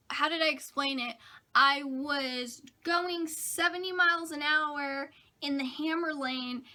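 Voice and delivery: normal talking voice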